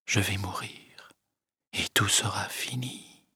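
soft, breathless whisper to herself; whispered thought. Acted. Emma thinking of death alone, whispered. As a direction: let the thought slip out as a whisper. Dying Emma's inner thought.

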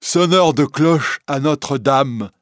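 loud, high, slow answer; deaf bellringer. A narrator's reading. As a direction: spell out who he is, slowly. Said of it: "répond Quasimodo". (le sonneur sourd, lent, haut et fort)

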